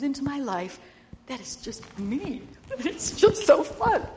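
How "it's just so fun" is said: high, slow laughing words; delighted laugh. Real, not acted. Grandma laughing about her look-alike granddaughter.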